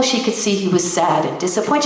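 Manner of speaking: usual speaking voice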